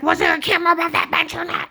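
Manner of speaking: high, loud mock interrogation; hammed-up furious agent demanding an answer; imitating angry agent. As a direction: bark it like a bullying agent. Agent shouting in retold quarrel.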